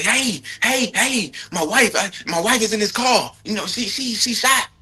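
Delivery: high-pitched, desperate calling for help; mock frantic husband yelling for help; imitating a frantic plea. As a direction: act out a panicked husband begging for help. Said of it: "He didn't say".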